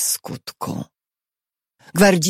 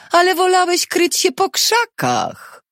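very high-pitched jeer; guard's high, scornful gibe; mocking. A narrator's reading. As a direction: taunt him in a raised, put-on tone. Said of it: "zadrwił gwardzista".

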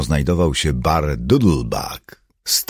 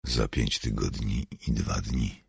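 low, soft mutter; subdued, low murmur; 4 semitones lower, 7 dB quieter than usual. A narrator's reading. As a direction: mutter it under the breath. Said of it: "mruknął kapłan".

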